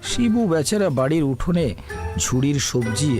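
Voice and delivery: ordinary talk, nothing marked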